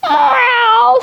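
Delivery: high, drawn-out meow-like ow; a long high stretching cat cry; cat stretching sound. Acted. Cat stretching in the story, high drawn-out ow. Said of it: "stretched Cat".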